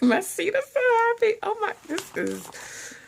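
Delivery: giggly delight. Real, not acted. Happy seat dance joke.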